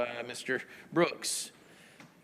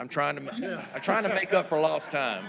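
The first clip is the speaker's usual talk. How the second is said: laughing; 5 semitones higher, 6 dB louder than usual. Real, not acted. Laughing at the auctioneer remark.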